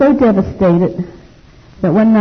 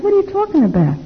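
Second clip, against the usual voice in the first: much higher, stunned retold question; shocked disbelief; 13 semitones higher than usual. Woman told her brother came, high shocked disbelief. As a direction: hear the news and can't believe it.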